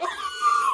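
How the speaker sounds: loud, very high shriek; hammed-up wailing screech; mock scream. She's going through the fire, mock scream.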